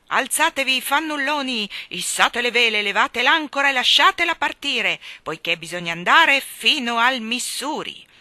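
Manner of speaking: loud, high admiral's commands; blustering sea-captain bellow; shouted orders. A narrator's reading. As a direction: rouse a lazy crew to set sail.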